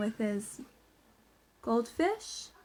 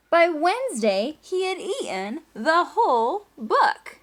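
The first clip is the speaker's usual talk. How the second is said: lively storybook reading, louder as it builds; reading to children; 5 semitones higher, 6 dB louder, slower than usual. A narrator's reading. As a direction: read it out to the class with rising excitement.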